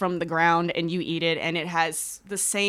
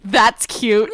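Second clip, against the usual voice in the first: sarcastic covid voice; 3 semitones higher, 11 dB louder than usual. Retold or imitated. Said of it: "covid was like".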